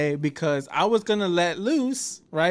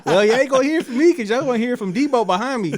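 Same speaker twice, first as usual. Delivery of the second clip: fast, louder, laughing through it; laughing retort; 6 semitones higher, 6 dB louder, faster than usual. Retold or imitated. Speaker telling staff the guy behind will deal with them, laughing. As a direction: crack up as you hand it off.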